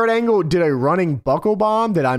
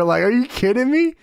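ordinary talk

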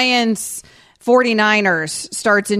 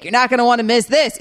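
ordinary talk

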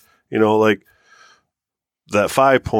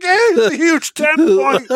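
laughing proud; 3 dB louder, slower than usual. Retold or imitated. Proud answer while laughing.

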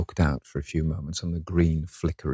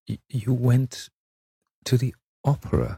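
halting, higher voice with a touch of pain; slow, strained pain; 8 semitones higher, 3 dB louder than usual. A narrator's reading. Hallward to Dorian about going to the opera, slow and strained with pain. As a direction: say it haltingly, pained and disbelieving. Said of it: "speaking very slowly and with a strained touch of pain".

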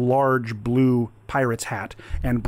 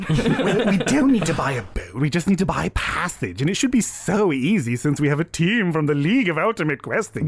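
higher, excited character voice; eager, overambitious Delilah; 5 semitones higher than usual. Acted. Delilah pitching passage to the party, excited. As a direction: gush eagerly about the plan. Game master plays Delilah.

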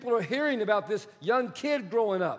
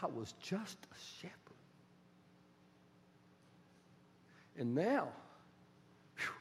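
hushed, soft, amazed at his own life; awed David; 4 semitones lower, 10 dB quieter than usual. Acted. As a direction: breathe it out in quiet wonder, half laughing. David awed, looking back.

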